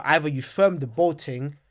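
normal talking voice

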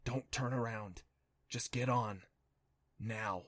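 soft, urgent whisper. A narrator's reading. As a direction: whisper a tense warning. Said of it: "Adam whispered".

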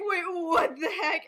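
squeaky-high baffled reaction, drawn out; shocked disbelief. Acted. Common room reacting to the news, stunned disbelief.

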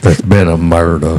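mock Southern accent with softened R; Savannah accent. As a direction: imitate a hammy Savannah gentleman's accent. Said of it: "A Savannah accent".

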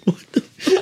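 very high, laughing; high laughing squeal while watching friends hang off the side; laughing disbelief. Retold or imitated.

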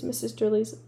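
ordinary delivery, nothing marked

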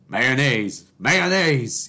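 mock-angry [name] stretching "Mayonnaise" by one syllable; [name]'s extra syllable. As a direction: shout "Mayonnaise" stretched out, adding a syllable in the middle. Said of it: "an extra syllable".

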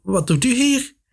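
high-pitched, unsure question; hesitant. A narrator's reading. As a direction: question the intruder uncertainly, voice rising high. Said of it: "vroeg een aarzelende stem".

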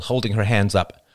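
ordinary delivery, nothing marked

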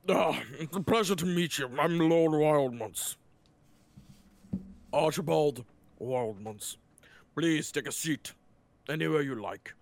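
a pompous, jowly rich lord, slow and grand; the lord welcomes the squad into his seating room; Lord Wildmont. Acted. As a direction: welcome your guests with lazy self-importance. GM voices the lord.

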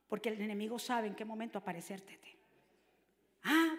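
ordinary delivery

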